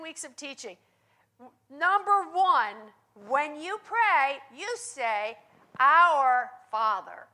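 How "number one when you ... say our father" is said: slow, weighty, "Our" punched loud; slow emphatic. Shown as a demonstration. Preacher slowly spells out Jesus' first prayer lesson, emphatic. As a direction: lay each word down slowly, hitting "Our". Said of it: "preacher dramatizes Jesus' instruction".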